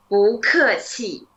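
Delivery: slow, carefully separated syllables; slow model phrase. Shown as a demonstration. Teacher asks student to follow, slow drawn-out model. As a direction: stretch each syllable so they can follow. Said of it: "follow me again".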